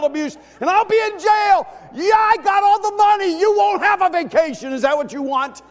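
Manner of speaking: high, loud mock fatherly rant; hammed-up angry dad. Dad ranting at the five girls in the back, loud mock anger. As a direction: threaten them like a dad at the end of his rope.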